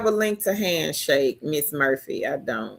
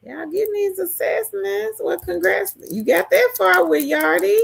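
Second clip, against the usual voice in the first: much higher, cheering congrats; high excited praise; 11 semitones higher than usual. Real, not acted. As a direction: lift high, proud of them. Congratulating viewers on assessments.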